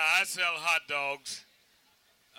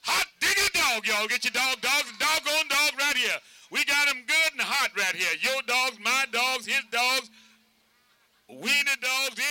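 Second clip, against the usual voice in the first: loud, high vendor's holler; hollered hot dog pitch; 7 semitones higher, 6 dB louder than usual. Shown as a demonstration. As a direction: holler it out to the ballpark crowd. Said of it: "but we holler".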